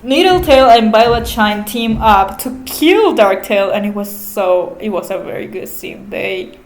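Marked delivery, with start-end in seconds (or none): none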